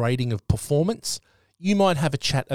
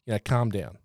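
soft, much quieter soothing words; calming someone down; 4 semitones lower, 7 dB quieter than usual. Retold or imitated. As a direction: hush her gently to calm her down. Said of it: "I'm just like".

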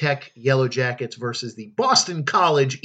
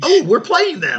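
excited surprise; 11 semitones higher, 6 dB louder than usual. Real, not acted. Realizes upcoming opponent.

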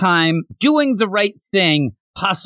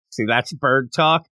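usual speaking voice

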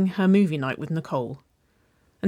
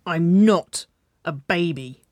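slow, louder angry protest; growled; 5 dB louder, much slower than usual. A narrator's reading. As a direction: growl it word by word as an offended child. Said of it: "she growled".

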